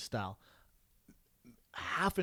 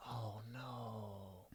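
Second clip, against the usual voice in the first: soft, drawn-out dread, acted out; hushed, low thought to oneself; telling oneself; 4 semitones lower, 13 dB quieter than usual. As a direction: murmur it inwardly as disaster slows down. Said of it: "telling myself, going".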